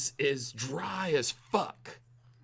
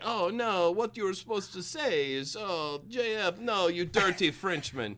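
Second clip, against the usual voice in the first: higher-pitched mock foreign accent, French-ish; one-size-fits-all foreign accent; 4 semitones higher than usual. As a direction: imitate him in a muddled foreign accent. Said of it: "I only have one accent, everyone".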